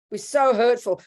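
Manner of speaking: ordinary talk, nothing marked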